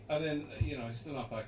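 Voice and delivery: usual speaking voice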